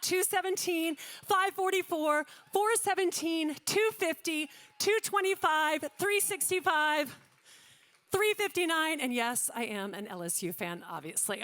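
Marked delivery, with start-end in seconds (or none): 0.0-9.0 rapid, rhythmic auction calling of paddle numbers; auctioneer calling. Acted. Auctioneer to gala room, calling paddles in rhythmic auction patter. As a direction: rattle off the bids like a live auctioneer.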